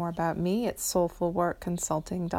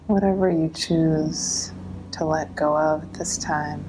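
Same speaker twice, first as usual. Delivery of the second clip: guided meditation; 4 dB louder than usual. Acted.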